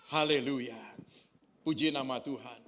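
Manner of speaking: ordinary delivery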